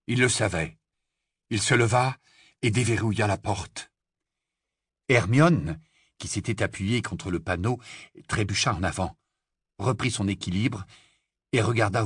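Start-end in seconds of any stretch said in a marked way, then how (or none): none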